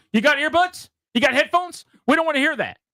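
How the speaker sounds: high mock demand; mock tough guy. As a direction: lightly ham up the pushy tough guy. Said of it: "and then goes".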